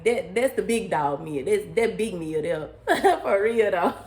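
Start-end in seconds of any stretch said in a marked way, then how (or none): 2.9-4.1 real laugh through the words. Real, not acted. Laughing after bragging about her big dog side, laughing. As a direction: laugh it off at your own boast. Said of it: "laughing at herself".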